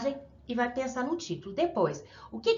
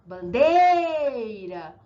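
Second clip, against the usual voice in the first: bandeira very slow on a loud, long DEI; stronger stressed syllable; 2 semitones lower, 7 dB louder, much slower than usual. Shown as a demonstration. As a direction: stretch the middle syllable of "bandeira". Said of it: "dizendo de sílaba mais forte".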